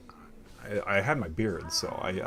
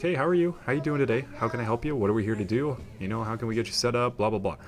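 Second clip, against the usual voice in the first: higher, upbeat cheery hello; friendly normal greeting; 6 semitones higher, 4 dB louder, faster than usual. Retold or imitated. Installer greeting a suspicious customer, extra-friendly. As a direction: sound as normal and friendly as possible. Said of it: "completely normally".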